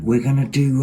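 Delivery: ordinary talk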